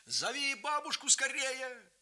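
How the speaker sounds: put-on high urgent call to the other boy; a young man's urgent high shout. A narrator's reading. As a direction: shout for grandma, fast. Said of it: "крикнул брату".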